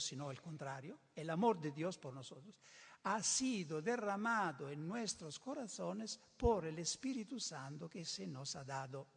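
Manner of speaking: ordinary talk, nothing marked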